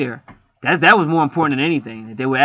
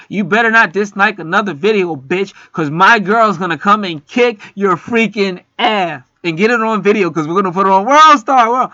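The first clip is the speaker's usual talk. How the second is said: mock threat; 6 semitones higher, 6 dB louder than usual. Vlogger threatening a video disliker in jest, loud playful trash talk. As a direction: bark it like a fake tough guy.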